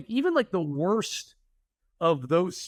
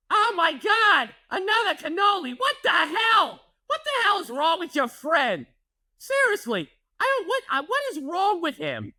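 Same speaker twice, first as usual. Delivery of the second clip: mother-in-law's shriek; 14 semitones higher than usual. Retold or imitated. Mother-in-law finding another hidden cannoli, high outraged shriek. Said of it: "her screaming obscenities".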